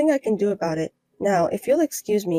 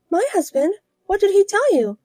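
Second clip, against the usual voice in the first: alarmed rival wife; 11 semitones higher, 3 dB louder than usual. Acted.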